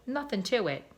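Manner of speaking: usual speaking voice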